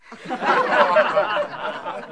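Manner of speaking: airy burst of real laughter; high breathless laugh. Real, not acted. Laughs at his own remark.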